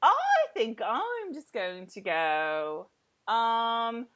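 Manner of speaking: high, loud hammed-up sweet schoolgirl; mock Claire. Mock Claire leaving the two alone, hammed-up and sugary. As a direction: play her as sugary matchmaker, then flounce off. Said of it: "they're walking around like".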